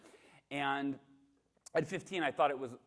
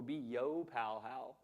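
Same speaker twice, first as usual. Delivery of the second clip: mock cool mom; 3 dB quieter, much slower than usual. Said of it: "make it cool".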